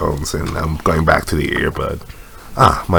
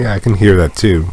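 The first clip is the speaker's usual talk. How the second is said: usual speaking voice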